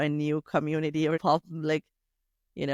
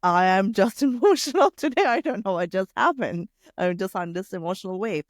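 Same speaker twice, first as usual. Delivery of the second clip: imitating emotional self; 4 semitones higher, 5 dB louder than usual. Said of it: "you're just like".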